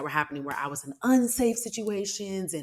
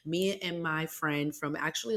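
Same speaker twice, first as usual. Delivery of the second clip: ordinary delivery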